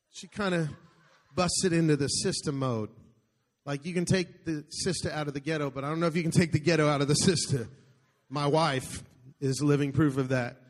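ordinary delivery

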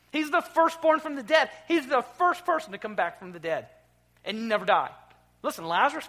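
fervent sermon crescendo; preaching at full force. Acted. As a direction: ring it out over the congregation. Celebrating the resurrection.

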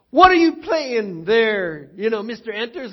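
put-on high, drawn-out questioning from a kid in class; challenging student. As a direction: lightly play a street kid sizing up his teacher.

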